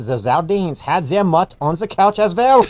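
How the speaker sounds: mock German accent, repeated deliberately; German stormtrooper accent. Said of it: "What's that, Stormtrooper?".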